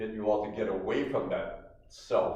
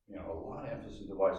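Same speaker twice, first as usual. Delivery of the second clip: quick aside repeat; 2 semitones lower, much faster than usual. Shown as a demonstration. Rushed softer repeat.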